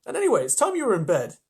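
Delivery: prim, motherly scolding, hurried along; talking like mother. Acted. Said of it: "Trying to talk like mother".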